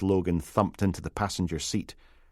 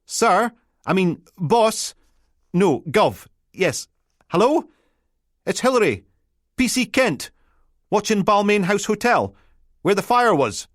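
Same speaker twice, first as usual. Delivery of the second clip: much higher, eager Peterhead constable; flustered Peterhead lass fumbling for the right title; a Peterhead constable calling her boss on the radio, flustered; Peterhead accent; 12 semitones higher, 5 dB louder than usual. Acted. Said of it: "A Peterhead accent".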